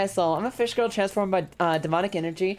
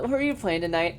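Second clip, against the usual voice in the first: usual speaking voice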